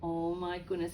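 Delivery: ordinary delivery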